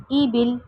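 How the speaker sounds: بل with the zer dropped and ل stopped; pause form. Shown as a demonstration. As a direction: end ای بل on a silent ل. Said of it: "حرف کو آخر میں ساکن کرتے". (وقف پر ساکن، ای بل)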